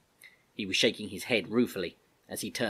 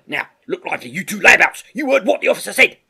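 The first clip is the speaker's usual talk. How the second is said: sergeant's shout, rising louder and higher; barked order; 11 semitones higher, 14 dB louder than usual. Acted. Sergeant ordering two layabouts to get moving, barked. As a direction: bellow at the slackers, building to the end.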